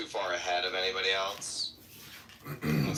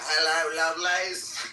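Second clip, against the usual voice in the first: high, loud stage greeting in mock British; hammed-up British hello to a crowd; fake British accent; 10 semitones higher, 5 dB louder, slower than usual. Greeting a British audience on stage, fake British accent. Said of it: "British accent".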